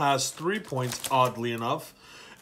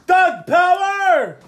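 drawn-out high hype call; hype shout; 14 semitones higher, 7 dB louder, much slower than usual. Real, not acted. Hyping up Doug's pick.

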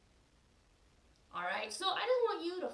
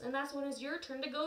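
ordinary talk, nothing marked

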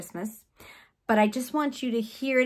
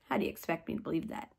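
quick, quiet, lower throwaway question; doubtful question; 5 semitones lower, 9 dB quieter, much faster than usual. Retold or imitated. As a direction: toss it off fast and low in disbelief. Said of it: "And Zechariah said".

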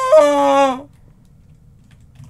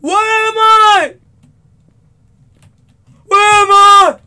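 higher, louder, slow lost cry; panicked cry; 6 semitones higher, 10 dB louder, slower than usual. Real, not acted. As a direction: call out lost and afraid. Lost in horror game, panicking.

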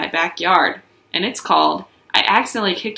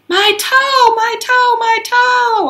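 very high, louder wailing cries; hollering; 5 dB louder, much slower than usual. Acted. Junie rolling in the grass, hollering. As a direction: wail like a hurt kid. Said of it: "I hollered real loud".

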